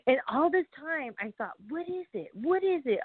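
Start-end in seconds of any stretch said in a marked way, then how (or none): 1.7-3.0 puzzled inner voice. Retold or imitated. Her own puzzled thoughts.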